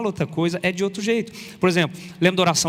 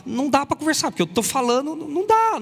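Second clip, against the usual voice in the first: exasperated; 10 semitones higher than usual. Retold or imitated. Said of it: "você fala".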